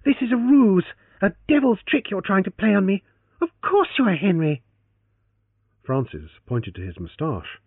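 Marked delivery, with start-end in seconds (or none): 0.0-4.6 high, loud accusation; furious certainty; accusing. Acted. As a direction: accuse him outright. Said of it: "she accused him".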